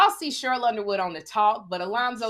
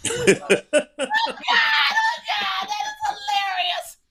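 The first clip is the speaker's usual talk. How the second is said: high shrieking laugh through the words; cracking up at her own story; laughing out loud, much higher; 5 dB louder, slower than usual. Real, not acted. Laughing at herself.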